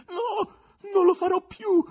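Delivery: high, loud gasping plea; rasping head. A narrator's reading. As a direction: wheeze out a promise. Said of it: "rantolò la testa".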